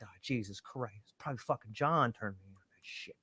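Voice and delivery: very soft, low self-talk; guilty inner muttering. Retold or imitated. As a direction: think aloud under your breath, acting out dread. Acted guilty thoughts to himself.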